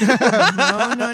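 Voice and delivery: loud, emphatic no. Real, not acted. She refuses to go to war, loud no. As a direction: shut it down firmly.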